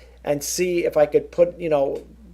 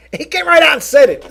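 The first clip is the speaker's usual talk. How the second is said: much higher, louder, amused disbelief; amazed exclamation; 11 semitones higher, 11 dB louder than usual. Real, not acted. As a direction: exclaim it, still half laughing. Amazed at blunt critic.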